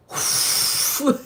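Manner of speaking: long, voiceless puff of air; imitated smoke blow. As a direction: blow out hard, as if expelling smoke. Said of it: "I just was like".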